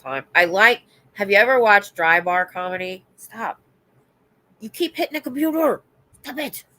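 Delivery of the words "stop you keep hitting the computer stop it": scolding the dog. Real, not acted.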